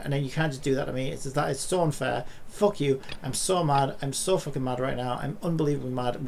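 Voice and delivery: ordinary delivery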